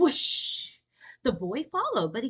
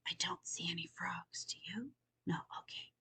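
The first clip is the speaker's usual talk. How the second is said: whisper; 12 dB quieter than usual. Real, not acted. Reader whispering to children about the frogs. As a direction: whisper as if not to scare the frogs.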